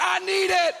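high, loud hammed-up plea; dramatic demand. Begging his wife for mouth to mouth, loud mock plea. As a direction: overact the need for rescue. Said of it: "I was like".

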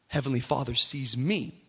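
ordinary delivery

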